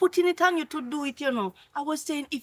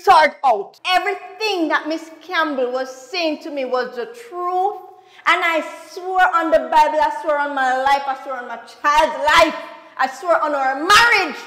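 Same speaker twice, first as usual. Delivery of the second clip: loud, forceful swearing; emphatic oath; 8 dB louder than usual. Acted. Wife swearing to her husband it's true, loud. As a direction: pound each oath home.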